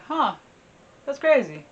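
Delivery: high, loud, casual amazement; mock surprise. Farmer hearing cats chant of a dead king, mock surprise. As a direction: pitch it up, lightly amazed.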